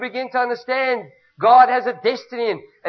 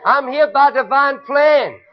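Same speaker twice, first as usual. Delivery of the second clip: louder and slow, bold declaration; congregation declaration; 3 semitones higher, 6 dB louder, slower than usual. Shown as a demonstration. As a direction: proclaim it to the room.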